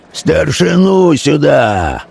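high, long-drawn call. A narrator's reading. Man behind the dealer summoning the elder, calling out. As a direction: call it out slowly over a crowd. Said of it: "Позади его Свищев кричал".

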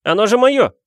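high, loud indignant cry; Harry, angry exclamation. A narrator's reading. Boy claiming his own letter, high angry shout. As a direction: protest hotly, pitched right up. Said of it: "гневно воскликнул Гарри".

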